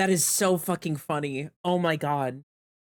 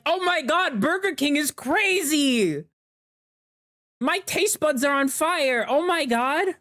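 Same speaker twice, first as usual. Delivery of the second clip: overexcited rave; 7 semitones higher, slower than usual. Retold or imitated. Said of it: "you'd be like".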